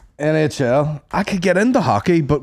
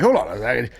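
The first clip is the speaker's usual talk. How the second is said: ordinary talk, nothing marked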